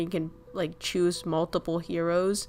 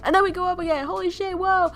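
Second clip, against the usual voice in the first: much higher and louder, exclaiming; excited surfacing; 11 semitones higher, 6 dB louder, faster than usual. Pretend. Streamer playing a dolphin bursting up again, loud and excited. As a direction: shoot up to the surface again with a shout.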